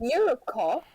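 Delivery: questioning attempt at British pronunciation; British accent. Shown as a demonstration. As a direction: try it in a British accent, rising at the end. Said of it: "That's British".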